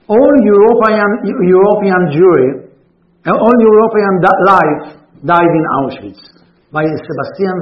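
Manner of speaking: formal reading. Acted. Rabbi reading a Spaniard's letter to his congregation, declaiming loudly. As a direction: read it like a proclamation to the congregation. Reads a quoted paragraph.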